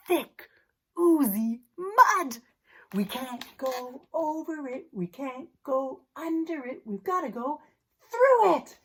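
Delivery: ordinary delivery, nothing marked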